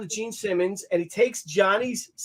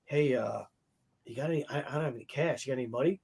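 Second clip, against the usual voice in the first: low, quiet mumbled request for cash; quietly cadging money; sheepish ask; 6 semitones lower, 10 dB quieter, faster than usual. Retold or imitated. [name] asking [name] for cash, hushed and sheepish.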